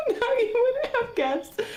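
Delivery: "now you wouldn't have guessed" still high and wobbly from the giggles; recovering from laughter. Real, not acted. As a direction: pull yourself together but still giggly. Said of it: "try to say it real quick".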